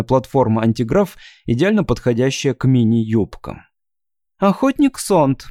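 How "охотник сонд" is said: put-on high chirping girl voice; chirpy thin voice. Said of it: "прощебетал тоненький голосок".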